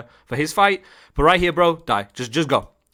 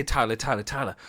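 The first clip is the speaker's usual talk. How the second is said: soft, hushed comforting voice; gently stroke him with the words; acted soothing; 8 dB quieter than usual. Coach consoling Tyler after he was let down, softly soothing. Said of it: "I'm gonna stroke Ty".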